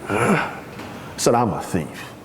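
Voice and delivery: very low, quiet tough-guy voice; Clint Eastwood. Acted. New inmate answers his cellmate, low tough-guy act. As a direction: drop right down and play the hard man. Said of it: "put on my Clint Eastwood, John Wayne".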